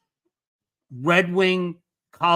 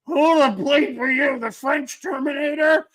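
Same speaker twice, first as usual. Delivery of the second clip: mock drunk taunt, pitched way up; hammered; 7 semitones higher than usual. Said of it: "And I'm hammered".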